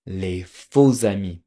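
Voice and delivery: Les faux amis, slow and loud, lay foh-za-mee; Les faux amis with a z liaison, drawn out; French pronunciation. Shown as a demonstration. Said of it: "There's something called".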